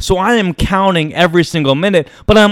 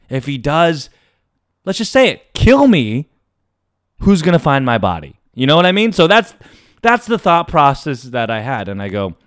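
ordinary delivery

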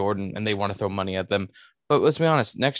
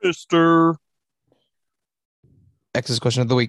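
announcer voice; 6 dB louder than usual. Pretend. Announcing the fan mail segment.

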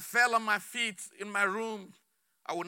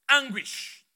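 ordinary delivery

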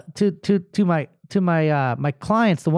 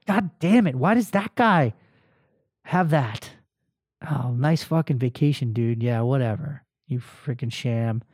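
annoyed ugh; 7 semitones lower, 4 dB quieter than usual. Retold or imitated. Envying a coworker's post.

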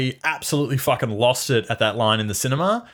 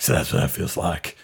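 low Batman voice; 8 semitones lower, 3 dB quieter than usual. Retold or imitated. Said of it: "being like".

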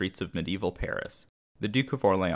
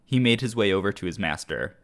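normal talking voice

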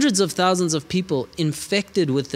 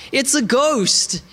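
high, slow, frightened cry of the disciples; terrified scream; 8 semitones higher, slower than usual. Acted. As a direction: cry out in terror at a ghost. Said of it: "they were terrified and they screamed out".

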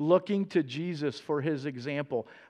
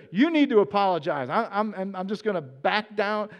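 louder and higher, refusing to back down; defensive argument; 4 semitones higher, 6 dB louder than usual. Pretend. Pretend self talking back to a spouse, defensive and heated. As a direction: pick a fight to dodge shame.

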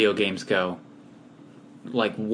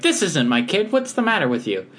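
high, indignant mother voice; a huffy penguin mom scolding; 7 semitones higher than usual. Retold or imitated. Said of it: "she's like".

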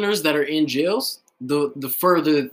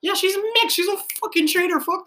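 excited; 13 semitones higher than usual. Real, not acted. Heated rant, pitch jumps high.

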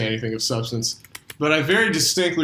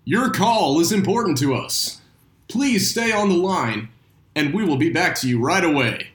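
phone menu voice; 5 semitones higher than usual. Pretend. Hold message during intermission.

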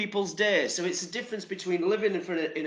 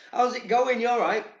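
louder and higher, bright hello; cheery greeting; 6 semitones higher, 5 dB louder than usual. Pretend. Vlogger acting out an upbeat greeting to strangers. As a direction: throw them a big friendly hello. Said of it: "Give 'em a".

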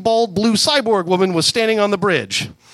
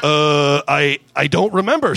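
stammering; 2 semitones lower than usual. A narrator's reading. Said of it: "stammered Star-Lord".

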